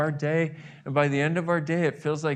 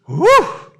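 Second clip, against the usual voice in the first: much higher, louder burst of amazement; high loud exclamation of delight; overwhelmed whew; 16 dB louder than usual. Pretend. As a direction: blow it out, stunned by the feeling. Overwhelmed by towel sensation.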